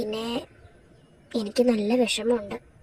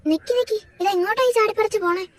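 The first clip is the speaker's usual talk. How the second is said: higher, calling out after her; sharp call after a sister slipping out; 7 semitones higher than usual. Acted. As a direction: call her back as she dashes off.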